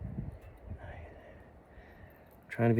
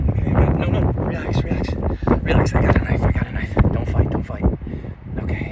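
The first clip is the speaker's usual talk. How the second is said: urgent calming; 7 semitones higher, 7 dB louder than usual. Real, not acted. Bird panics as knife appears.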